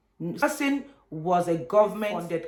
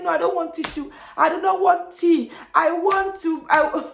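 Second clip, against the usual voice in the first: high, loud mock wail; tearful protest, breaking off; imitated crying; 10 semitones higher, 5 dB louder than usual. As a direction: refuse through hammed-up sobs. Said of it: "I started crying".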